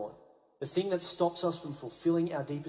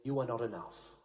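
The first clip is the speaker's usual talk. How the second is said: hushed, softer taunting inner voice; lying voice; 7 semitones lower, 7 dB quieter than usual. Acted. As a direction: drop into a sly quiet lie. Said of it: "that lying voice that can sometimes whisper".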